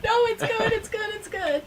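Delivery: high, loud, tearful-sounding gush; overwhelmed reassurance. Retold or imitated. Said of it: "I'm like".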